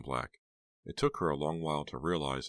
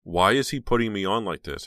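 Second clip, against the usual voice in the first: louder, higher, incredulous questioning; agitated inner thought; 5 semitones higher, 10 dB louder than usual. A narrator's reading. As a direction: voice her exasperated disbelief loudly. Heroine's agitated thoughts, voiced.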